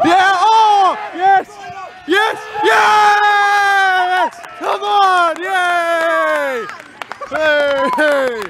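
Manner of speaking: high loud cheering for a goal; yelling from the sidelines. Real, not acted. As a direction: celebrate the goal at full voice. Fan celebrating equaliser goal.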